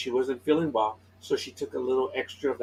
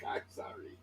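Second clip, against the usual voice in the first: whispering; 16 dB quieter than usual. Retold or imitated. Sheepish apology in a retold story.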